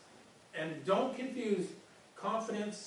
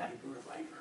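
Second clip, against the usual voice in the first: low gravelly-free growl, deep and quiet; mock Batman, pitched way down; lightly hammed Batman impression; 5 semitones lower, 10 dB quieter, much faster than usual. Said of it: "like, in a Batman voice".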